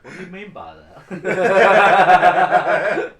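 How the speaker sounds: slow, loud words through laughter; laughing. Real, not acted. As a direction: laugh while asking. Innuendo laughter.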